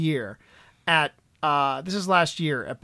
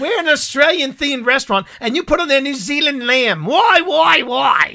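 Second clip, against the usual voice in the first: higher, louder mock Kiwi rant; bad New Zealand accent, getting more worked up; 8 semitones higher, 5 dB louder than usual. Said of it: "worst New Zealand accent I've ever heard".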